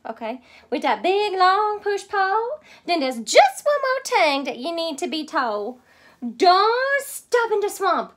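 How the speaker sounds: high, loud Cajun mama; Mama's voice. Acted. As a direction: call it out like a bossy Cajun mother. Mama instructs her daughter.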